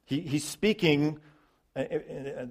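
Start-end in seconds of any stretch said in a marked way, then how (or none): none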